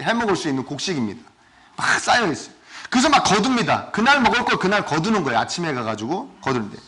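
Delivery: ordinary talk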